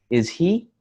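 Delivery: HE lifted high as a question; rising question intonation. Shown as a demonstration. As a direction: ask it, pitch climbing on HE. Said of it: "my pitch got higher".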